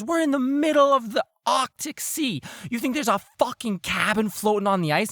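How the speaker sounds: loud, high hammed-up outrage; incredulous shouting. Crewman mocks friend's cabin idea, incredulous shouting. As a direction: yell in disbelief at a foolish friend.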